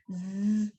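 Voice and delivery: low "Z" held like a little wasp; buzzing Z. Shown as a demonstration. Teacher showing children the little wasp sound, buzzing Z. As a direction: buzz a long, voiced "Z". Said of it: "Esa es la de la avispita".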